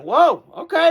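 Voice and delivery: sudden high, loud whoa of surprise; surprised whoa. Retold or imitated. Watching the game last night, surprised and impressed. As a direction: blurt it out, genuinely caught off guard. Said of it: "I went".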